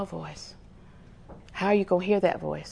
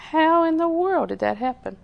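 astonished, pitched much higher; amazed question; 8 semitones higher than usual. Retold or imitated. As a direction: wonder how it could ever happen. Excavators amazed at the wall.

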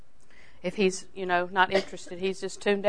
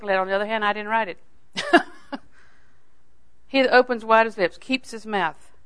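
ordinary talk

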